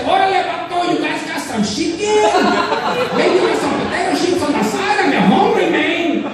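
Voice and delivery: high, hammed-up vato impersonation. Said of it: "the handkerchief on his head".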